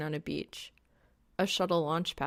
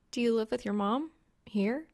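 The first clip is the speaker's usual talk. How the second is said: put-on lightly higher girl's voice; young woman voice; 5 semitones higher than usual. A narrator's reading. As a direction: voice her a bit higher and brighter. Reaper asks.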